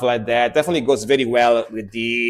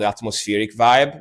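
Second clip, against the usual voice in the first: usual speaking voice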